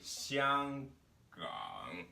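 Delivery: xiang at mid pitch, gang sunk very low; first tone set too low. Shown as a demonstration. Teacher showing trouble reaching the third tone, too-low tone pair. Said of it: "xiang and produced it here".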